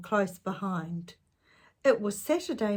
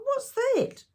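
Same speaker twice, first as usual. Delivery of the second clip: put-on much higher, louder childish question; little brother asking; 4 dB louder than usual. A narrator's reading. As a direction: ask like a startled small boy. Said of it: "said Tom".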